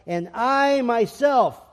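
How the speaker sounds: I myself, slow, I stressed; emphatic I myself. Shown as a demonstration. Quoting Jesus to the church, stress on I. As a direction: lean hard on I in I myself. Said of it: "it's emphatic in Greek".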